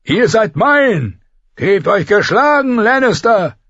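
slow loud triumphant roar; bellowing. A narrator's reading. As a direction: demand surrender at full voice. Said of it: "brüllte er herab".

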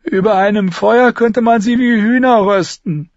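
put-on a hungry wounded boy talking up; Lommi's voice. A narrator's reading. Lommi dreams aloud of roasting them.

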